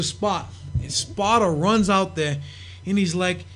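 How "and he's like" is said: ordinary delivery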